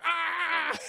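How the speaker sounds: long, loud, high mock yell; mock swinging scream. Streamer imitates Jack swinging on a crane, drawn-out mock scream. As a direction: holler as if flung through the air.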